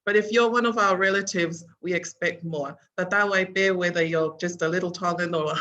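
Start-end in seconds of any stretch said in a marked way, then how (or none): none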